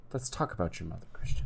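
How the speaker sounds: soft, calm, probing therapist tone. Acted. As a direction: ease into it like a counselor. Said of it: "That was my impression of a therapist".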